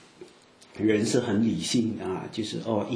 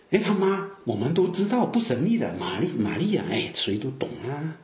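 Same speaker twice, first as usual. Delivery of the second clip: high, swooping village-gossip scoff; mocking; 4 semitones higher than usual. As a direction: scoff as if everyone knows that family. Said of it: "这些话都是嘲笑的意思".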